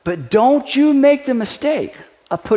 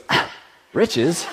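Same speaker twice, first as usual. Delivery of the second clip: dismissive ah; 4 semitones lower, 5 dB quieter than usual. Shown as a demonstration. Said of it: "ladies, you can go".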